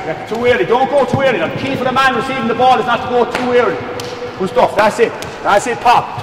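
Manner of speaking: loud shouted coaching across the pitch; calling out to players. Real, not acted. Coach shouting to players mid-drill, loud calls. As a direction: shout instructions so the lads hear you.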